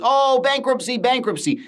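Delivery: high mocking whine on bankruptcy; mocking panic. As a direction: mimic the panicking commenters, pitch jumping up. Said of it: "people that are screaming".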